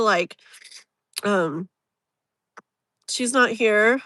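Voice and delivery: ordinary talk, nothing marked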